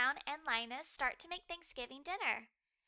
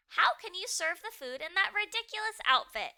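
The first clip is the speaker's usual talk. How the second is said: sharp, louder scold, pitched up; 8 semitones higher, 6 dB louder than usual. A narrator's reading. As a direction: tell the dog off for the silly outfit. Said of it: "he scolds Snoopy".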